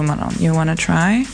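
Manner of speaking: normal talking voice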